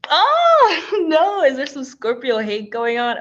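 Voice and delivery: loud, high "oh" of protest, easing off; playful mock outrage. Presenter to audience teasing Scorpios, mock outrage. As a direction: cry out in teasing disbelief, half laughing. Reacts to teasing about her sign.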